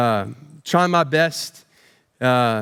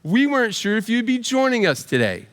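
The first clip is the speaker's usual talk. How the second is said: a hint of a sweet, higher teacher voice, "Oh" pitched up; lightly voiced polite teacher, mock surprise; imitating the teacher; 6 semitones higher than usual. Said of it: "greeted by her second grade teacher who said".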